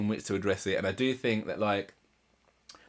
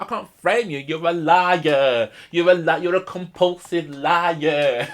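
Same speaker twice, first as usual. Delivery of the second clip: higher, louder, slow and deliberate; vexed accusation; 6 semitones higher, 7 dB louder, slower than usual. Retold or imitated. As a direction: spell out each word of the accusation. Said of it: "she's getting vexed".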